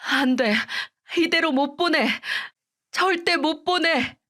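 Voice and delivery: begging him to open his eyes, near tears; wailing grief. Retold or imitated. Widow pleading with her dead husband, wailing. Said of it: "거의 미친 사람처럼 울부짖었어요".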